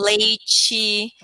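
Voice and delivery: "leite" said slowly as LAY-chee. Shown as a demonstration. Teacher showing the Brazilian T problem, slow "LAY-chee" for "leite". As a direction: soften the T of "leite" into a drawn-out "chee". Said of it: "é o T".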